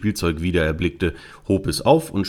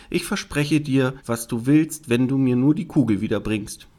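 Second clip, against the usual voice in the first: high, pleading princess voice; promising princess; 5 semitones higher than usual. Acted. Princess promising the frog anything for her ball, eager. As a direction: play a princess promising readily.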